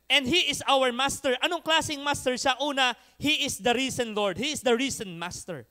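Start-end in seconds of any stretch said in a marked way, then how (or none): none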